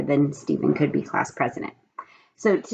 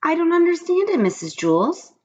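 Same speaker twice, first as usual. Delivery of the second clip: lightly voiced upset little girl; whiny child complaint; plaintive girl; 11 semitones higher than usual. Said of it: "cried Mauricia".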